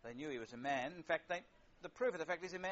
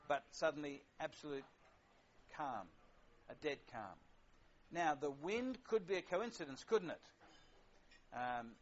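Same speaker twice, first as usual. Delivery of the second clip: ordinary talk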